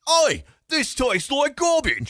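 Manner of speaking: high, slow, hammed-up Cockney; Cockney accent. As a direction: play a cartoon Cockney bloke tasting bad food. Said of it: "more of a Cockney accent".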